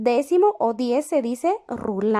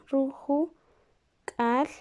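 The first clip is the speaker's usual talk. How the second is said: stretched out syllable by syllable; very slow dictation; 4 dB quieter, much slower than usual. Shown as a demonstration. Teacher to students while writing, very slowly stretched. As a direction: drag each sound out as you write. Teacher writes word on board.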